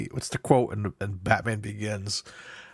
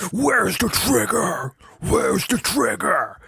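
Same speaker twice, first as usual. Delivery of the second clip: much higher, loud, slow gravel-voiced yell; yelling a Batman line; 11 semitones higher, 4 dB louder, slower than usual. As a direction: bellow it down the hallway like Batman. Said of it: "me and you just yelling".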